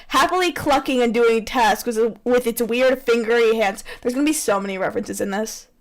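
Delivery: usual speaking voice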